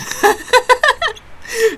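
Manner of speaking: a high, loud burst of laughter; real delighted laugh. Real, not acted. Gamer cracking up at the chaos, high loud laughter.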